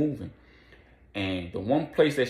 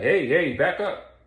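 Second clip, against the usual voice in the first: louder and higher, warding her off; startled shout; 7 semitones higher, 6 dB louder, slower than usual. Retold or imitated. Captain shooing off a coughing bum, loud and startled. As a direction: bark at someone crowding you. Captain shoos away disguised woman.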